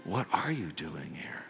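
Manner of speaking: God whispering to Elijah at the cave; whispered voice of God. Acted. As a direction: let God's question come as a whisper. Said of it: "a voice said to him".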